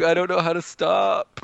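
high-pitched retold panic; freaking out. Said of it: "like, freaking out".

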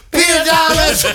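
high, loud imitation of a hostess calling out; hammed-up holler for a missing husband; mock yell. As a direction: call the name out shrieky and high, in jest. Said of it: "Cynthia says again".

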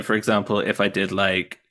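ordinary talk, nothing marked

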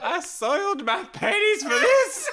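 high, loud laughing shout; mock fury with laughter; laughing rant. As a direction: yell it in comic outrage. Ranting about disappointing news.

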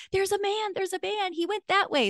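very high, frantic squeal; panicked alert. Retold or imitated. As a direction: point out an intruder in panic. Frightened daughter alerts her mother.

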